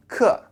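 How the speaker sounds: "ke" dropping sharply in pitch; falling intonation. Shown as a demonstration. Teacher demonstrating the word's tone, falling tone on "ke". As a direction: start "ke" high and let it fall. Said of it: "say this word with a falling intonation".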